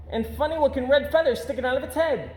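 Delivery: cub voice. Acted. Cub adds to lie.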